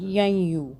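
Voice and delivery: यई यू with the n only a nasal glide into y; partial noon. Shown as a demonstration. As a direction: join यई यू through a hum, no full n. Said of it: "इसे नून पूरा नहीं पढ़ेंगे".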